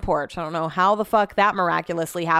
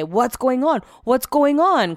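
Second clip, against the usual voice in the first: higher-pitched imitation of a housemate checking in; Brett; 5 semitones higher, 4 dB louder, slower than usual. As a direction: mimic him calling out, higher and repeated. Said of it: "thought Brett was gonna come up in here and say".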